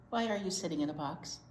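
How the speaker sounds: ordinary talk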